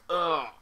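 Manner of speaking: loud exasperated ugh; embarrassed groan. Real, not acted. After mixing up Montreal and Detroit, loud groan. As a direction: groan at your own mistake. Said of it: "I, yeah".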